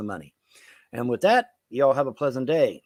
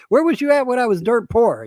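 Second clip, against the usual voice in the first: louder, higher accusing retort; mock indignant; 10 semitones higher, 7 dB louder than usual. Man rebuffing gold-digging relatives, higher and louder. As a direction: throw it back at the fake relatives. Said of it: "it's like".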